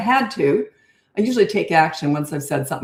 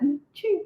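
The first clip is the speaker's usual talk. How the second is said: high squeaky little sneeze like a moose squeak; 9 semitones higher, 4 dB quieter than usual. Retold or imitated. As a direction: imitate a tiny squeaky sneeze. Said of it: "moose squeak sneezes".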